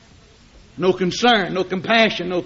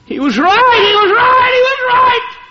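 a damned soul realising too late; terrified moan growing into a wailing shout; anguished soul in hell; 14 semitones higher, 4 dB louder than usual. Acted. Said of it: "You'll say".

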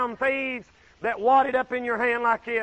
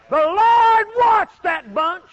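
slow loud declaration; 10 semitones higher, 12 dB louder, much slower than usual. Real, not acted. Preacher emphasising God's watch.

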